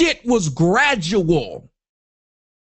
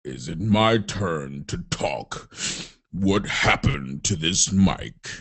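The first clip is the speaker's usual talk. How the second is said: put-on very deep, slow, soft sinister old senator voice; Palpatine impression; 7 dB quieter, slower than usual. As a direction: rumble it low and slow like a dark lord. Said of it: "Senator Palpatine, he'll come over here".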